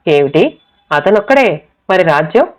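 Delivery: ordinary delivery, nothing marked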